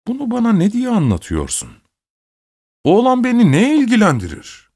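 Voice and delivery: very high-pitched indignant questions; irritated outburst. A narrator's reading. Master rebuffs housekeeper's news of his nephew's death, irritated. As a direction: snap in a high, exasperated voice.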